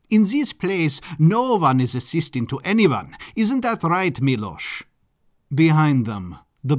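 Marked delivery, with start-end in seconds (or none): 0.1-4.8 high, emphatic Baron voice; flamboyant Baron. Acted. Baron welcoming an assistant as an equal, flamboyant and emphatic. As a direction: declare it grandly, then turn to the butler.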